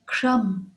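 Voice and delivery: crumb said alone, ending on M; "crumb" closing on a clean M; silent B. Shown as a demonstration. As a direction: drop the B at the end of "crumb". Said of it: "but leave it out".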